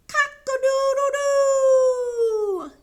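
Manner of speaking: loud, high, long-held crow; full-voiced cock-a-doodle cry; screaming rooster crow. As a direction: belt out a rooster crow, stretching the last note. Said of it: "he screamed".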